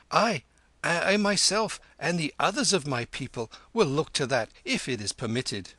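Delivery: hasten to offer service, then defer; eager fawning. A narrator's reading. Hillman offering his people's help to Kim, eager fawning.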